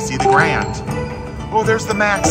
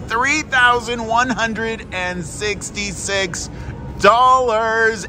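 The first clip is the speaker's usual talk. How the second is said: shrieking jackpot winner; 7 semitones higher, much slower than usual. Real, not acted. Announcing the jackpot amount.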